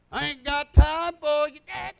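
shouting it out, laughing at the devil; loud and defiant, refusing him; loud defiant retort. Retold or imitated. Teresa rebuking the devil, loud and defiant. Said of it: "and she said".

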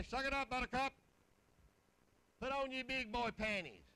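lightly voiced tough wife; a hint of a rough, no-nonsense wife; mock stern wife telling him to toughen up. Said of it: "she just sounds rough".

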